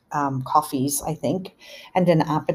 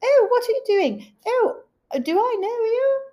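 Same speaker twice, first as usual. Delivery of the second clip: put-on much higher, slow, startled old lady; surprised old mom; 3 dB louder than usual. Mother on the toilet greeting a stranger crawling in, baffled. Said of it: "Mom's like".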